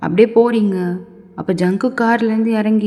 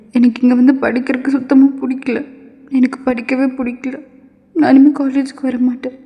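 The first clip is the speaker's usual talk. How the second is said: put-on upset student girl; 3 semitones higher than usual. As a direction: protest in a raised, distressed voice. (வருத்தத்தோடு உயர்ந்த குரலில் மறுப்பு)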